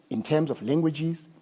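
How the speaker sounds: ordinary talk, nothing marked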